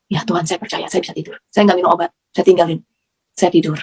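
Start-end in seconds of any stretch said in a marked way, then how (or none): 0.1-1.4 inner prayer, hushed. Retold or imitated. Seperti ada bisikan seperti itu.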